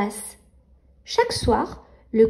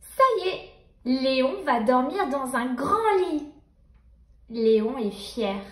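ordinary delivery, nothing marked

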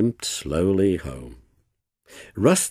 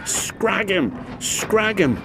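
high, fierce yell; 12 semitones higher, slower than usual. A narrator's reading. Spiteful trucks charging downhill, yelling high. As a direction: howl for revenge. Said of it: "they yelled".

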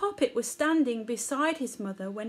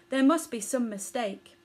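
ordinary delivery, nothing marked

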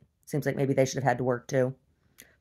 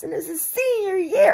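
high, whiny fake sob breaking into a laugh; laughing mock cry; mock sobbing mother; 5 dB louder than usual. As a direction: wail it as a parody of her.

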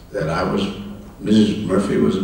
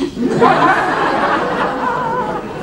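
high amused laugh. Real, not acted. Laughing at his own three ex-wives joke. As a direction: laugh at the punchline.

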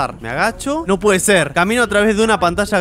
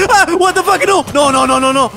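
high, loud frightened protest; pleading no no no in fright; scared outburst; panicked no's; 9 semitones higher, 6 dB louder than usual. Real, not acted. Monster appears suddenly.

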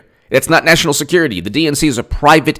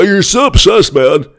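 higher, louder taunting voice; mock critic; 6 semitones higher, 4 dB louder than usual. Mocking viewers who call him obsessed, higher sneer. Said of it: "People are like".

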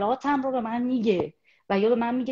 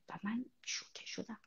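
breathy hushed whisper, voice gone; shocked whisper; 15 dB quieter than usual. Real, not acted. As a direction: whisper it as if still stunned. Recalling shock at the screening.

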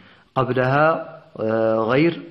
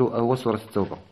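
usual speaking voice

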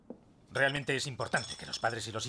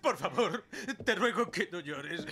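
high, slow, tearful pleading; begging through tears; weeping; 11 semitones higher than usual. Acted. Son weeps, begging father.

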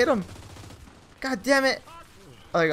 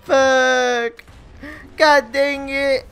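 frustrated groan; 7 semitones higher, 9 dB louder, much slower than usual. Real, not acted. Losing the round.

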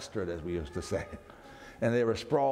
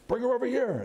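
much higher, urgent hospital shout; urgent call out; 13 semitones higher, 3 dB louder than usual. Retold or imitated. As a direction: call out for the patient. Hospital staff calling out.

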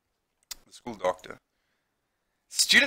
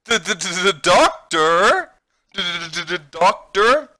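stammering, higher, loud shock; surprised stutter; 6 semitones higher, 7 dB louder than usual. Acted. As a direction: stumble over the words, rising to a question. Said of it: "Why am I stuttering?".

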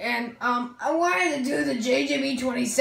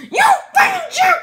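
angry shriek; 8 dB louder than usual. Acted. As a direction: shriek it in outrage. [name]'s put-on voice.

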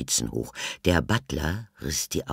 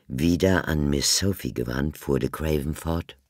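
ordinary delivery, nothing marked

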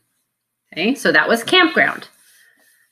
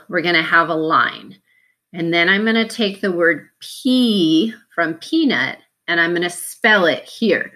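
normal talking voice

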